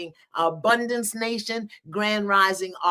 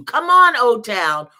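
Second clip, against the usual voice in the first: loud, high call to the room; 4 semitones higher, 9 dB louder, slower than usual. Real, not acted. Host calling viewers into the global living room, loud and high. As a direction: shout it out to pull them in.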